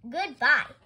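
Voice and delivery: loud, firm send-off; pointed dismissal. A narrator's reading. Mister Rogers gets rid of Amelia at the office, pointedly. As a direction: dismiss them with one loud word. Getting rid of his headache.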